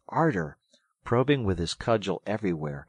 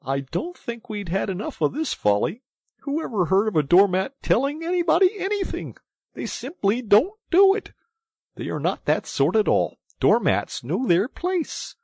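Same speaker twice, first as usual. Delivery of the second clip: high, loud, huffy scolding; pettish; 13 semitones higher, 9 dB louder than usual. A narrator's reading. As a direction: dismiss the nonsense indignantly. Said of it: "said the mole quite pettishly".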